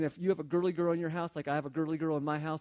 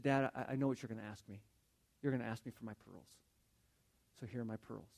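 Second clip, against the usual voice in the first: low, soft, sad surrender; quiet, reluctant giving-up in an imitated child's part; tearful little girl; 5 semitones lower, 5 dB quieter than usual. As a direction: retell it as a sad child giving in, voice dropping away. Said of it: "there's a tear running down her cheek".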